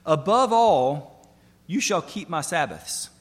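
"Above all" slow and loud, the rest settling down; stress on above all. Shown as a demonstration. Preacher pointing the congregation to a phrase, slow and weighty. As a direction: lean hard on "Above all", drawn out. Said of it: "Do you see that phrase, "above all".

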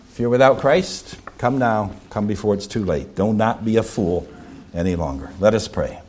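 low, hushed closing appeal; solemn invitation. Real, not acted. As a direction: quietly urge them to come, voice sinking.

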